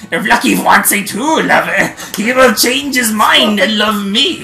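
loud, high, hammed-up French accent; grand lover's declaration in a rough French accent; put-on accent drifting from German to French. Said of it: "That definitely turned French".

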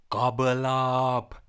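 plain reading voice

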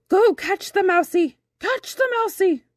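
high, excited egging-on; high-pitched call, urgent and loud; great-aunt urging cat. A narrator's reading. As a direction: sic the cat on a mouse. Great-aunt urges cat to hunt.

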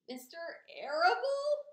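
loud, high wail of disbelief; sobbed question. A narrator's reading. Pig learns Fern's father joins in, sobbed question. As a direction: wail the name upward in distress. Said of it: "sobbed Wilbur".

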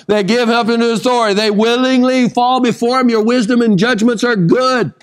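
high-pitched, sustained sermon declaration; fervent preaching. Real, not acted. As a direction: proclaim it to the congregation with conviction.

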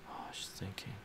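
hushed narration; soft breathy aside; whisper. Real, not acted.

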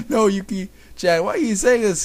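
high, pleading voice; plead, about to cry; close to tears. A narrator's reading. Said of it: "Tsukasa was getting ready to cry".

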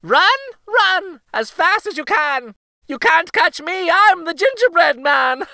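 high, loud, gleeful taunt; sing-song jeer at his chasers; gingerbread boy taunting shout. A narrator's reading. Runaway cookie shouting back at old couple, high gleeful taunt. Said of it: "but he just laughed and shouted".

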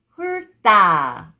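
훑다 run together as 훌따, tense tt; read together. Shown as a demonstration. Teacher shows how it reads together, linked pronunciation. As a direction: join 훑다 with a hard 따. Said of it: "you have to read it this sound".

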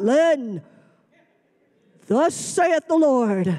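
slow, solemn hammed-up prophet; mock prophet voice. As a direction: parody a spooky preacher, dragging it out. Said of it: "he didn't act like that".

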